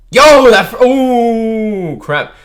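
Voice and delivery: real jolt of surprise at the page; sudden high shout of shock; startled shriek. Real, not acted. Shocked by sudden panel reveal.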